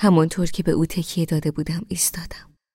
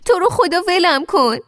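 much higher, louder desperate plea; pleading; 13 semitones higher, 7 dB louder than usual. Acted. Girl begging Amir at the cliff edge, desperate pleading. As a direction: beg him to let go. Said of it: "با التماس دوباره گفتم".

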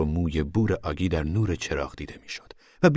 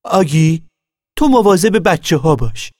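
higher and louder call across the car; 10 semitones higher, 9 dB louder than usual. Acted. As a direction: call to Aggie at a distance. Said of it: "صدا زد".

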